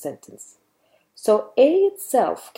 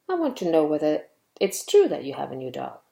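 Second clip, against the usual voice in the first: usual speaking voice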